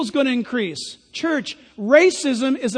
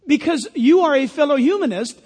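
ordinary talk

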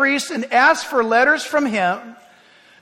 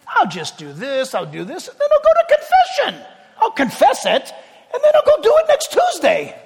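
much higher, laughing mock voice; hammed-up laughing sinner planning a confession; mocking religious excuse; 7 semitones higher than usual. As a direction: send up the loophole with a giddy laugh. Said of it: "We used to think that way when we were religious".

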